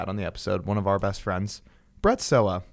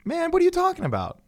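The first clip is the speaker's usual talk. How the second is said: mock outrage. Host teasing a co-host, squeaky mock disbelief. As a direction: act shocked he would deny it.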